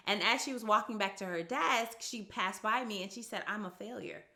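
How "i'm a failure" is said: very soft imitation of a dejected child; hushed, defeated little admission, retold; retold dejection. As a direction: quote it small and quiet. Retells a girl's dejected remark.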